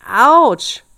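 storybook devil's startled yell; pained cry. A narrator's reading. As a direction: cry out as if a hair was yanked. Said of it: "schrie der Teufel".

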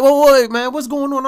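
mock-startled, trying to calm him down; taken aback. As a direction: imitate someone backing off from an outburst. Coworker startled by angry outburst.

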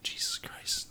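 breathy whispered exasperation; exasperated whisper. Real, not acted. Fed up with Eugene's question, whispered. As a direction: mutter it under your breath in disbelief. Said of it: "exasperated whisper".

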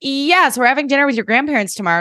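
voiced TV mom. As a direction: lightly act out a mom announcing plans. Recap voices mother announcing dinner plan.

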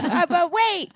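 high, loud comic interjection; mock urgent. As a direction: burst in loudly through the laughter. Joking interjection amid laughter.

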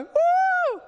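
high, loud whooping cheer; mock cheer. The congregation's pretend response to the commission, whooping loudly. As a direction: whoop like an excited crowd. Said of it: "To which we all go".

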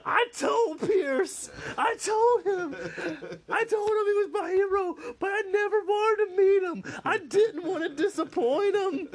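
very high, loud, slow wailing sob voice; crying freakout impression. Troy sobbing alone after meeting his hero, hammed-up wail. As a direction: wail it like a man sobbing with joy. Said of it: "He's like".

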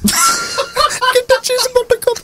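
very high, drawn-out silly squeal; slow, squeaky quote from a comedy film; movie character imitation. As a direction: put on a tiny high voice, stretching it out. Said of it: "when Buttercup's trying to get the cheese".

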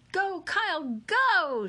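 high, loud cheerleader shout; shouted cheer. A narrator's reading. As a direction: yell it out to cheer him on. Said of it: "shouted Haley".

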